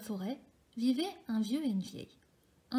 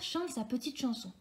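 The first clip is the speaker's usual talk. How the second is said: ordinary delivery, nothing marked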